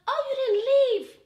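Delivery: high, loud retold exclamation; surprised relief. Finding her date still waiting, surprised relief. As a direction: pitch it way up, delighted surprise.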